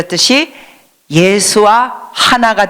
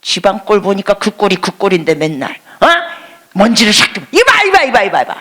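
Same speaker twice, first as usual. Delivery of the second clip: angry husband. Acted. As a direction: snap at your tired wife in a hammed-up rage. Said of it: "남편이 도끼 눈이 되면서".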